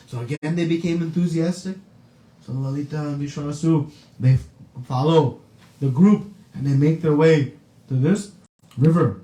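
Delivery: ordinary talk, nothing marked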